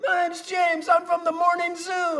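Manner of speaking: high, shouted morning-zoo DJ hype; hammed-up emcee yell; radio host introduction. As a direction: fire up the crowd like a rock-station emcee. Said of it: "the guy comes out to introduce me".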